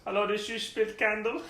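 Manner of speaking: lightly voiced Adam Sandler impression. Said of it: "Now I'm just gonna do an Adam Sandler".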